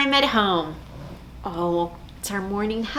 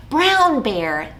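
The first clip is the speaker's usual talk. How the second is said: ordinary talk, nothing marked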